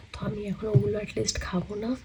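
normal talking voice

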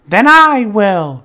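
high, loud, slow and firm; determined hen. A narrator's reading. The hen taking on the work herself, high and firm. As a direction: plant each word like a stubborn little hen. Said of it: "said the little red hen".

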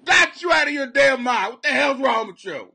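loud, high scolding after a laugh; hammed-up indignant shout; mock outrage. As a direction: yell at them in playful disbelief. Mock outrage at viewer's joke.

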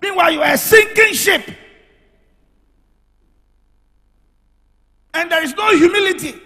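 loud, high preaching at full force; preaching shout. Acted. Preacher shouting a warning at the congregation. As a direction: thunder it over the congregation.

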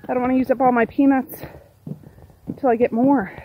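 usual speaking voice

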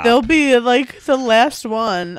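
real teary confession about last kisses; crying. Real, not acted. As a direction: voice caught in real tears. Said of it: "I'm literally crying".

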